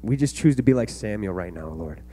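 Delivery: closing prayer. Acted. Pastor prays over the kids, soft and earnest. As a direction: pray them out gently, like speaking to a close father.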